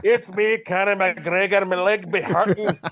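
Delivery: put-on broad comic Irish accent; Conor McGregor. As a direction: play a boastful fighter in stage Irish. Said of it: "Do we have Connor on the line".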